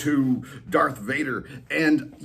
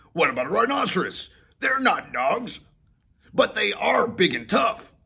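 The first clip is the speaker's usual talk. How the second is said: higher, eager dog voice; dog suggesting; 10 semitones higher, 4 dB louder than usual. Acted. Molly suggesting rhinoceros to Whiskers, higher voice. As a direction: pitch the idea.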